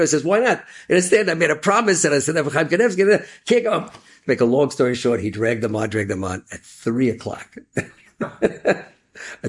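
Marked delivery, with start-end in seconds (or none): 0.2-3.9 raised voice, urgent plea; high, loud pleading. Acted. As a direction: insist hard, pitched up. Kid pleads for the minyan.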